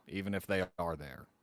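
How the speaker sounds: ordinary talk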